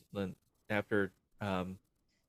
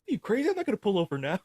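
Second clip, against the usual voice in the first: mock exasperation; 11 semitones higher, 4 dB louder, faster than usual. Acted driver refuses to stop.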